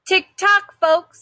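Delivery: high, loud hurry-up call; impatient officer. Acted. The officer hurries the shelter staff, loud and impatient. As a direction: rush them along. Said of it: "says an older, ruddy-faced officer".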